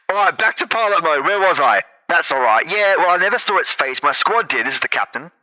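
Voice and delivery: loud gruff captain; alternating two voices. Acted. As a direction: bark it like a captain. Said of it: "this will be the captain's voice".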